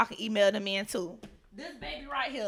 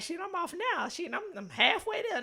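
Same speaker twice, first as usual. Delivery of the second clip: flustered lie; 8 semitones higher than usual. Retold or imitated. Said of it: "I'm in the car like".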